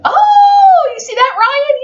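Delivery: loud high squeal of delight; excited oh. Real, not acted. Parent spotting rabbit food and calling to Ryan. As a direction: burst out with glee.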